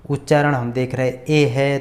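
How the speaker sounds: ordinary delivery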